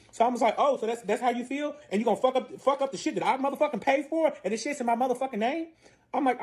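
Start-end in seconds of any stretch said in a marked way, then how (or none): 0.6-5.7 high-pitched, affronted retort; retold quarrel, voice pitched well up; acted indignation. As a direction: argue back in offended disbelief. Acted quarrel over wrecked suite.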